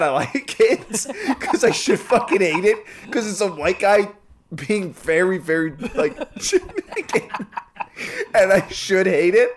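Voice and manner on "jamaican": laughing. Real, not acted. Laughing while saying Jamaican.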